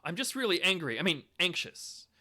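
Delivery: a patient catching their own slip mid-sentence; patient's slip. Retold or imitated. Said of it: "a patient says".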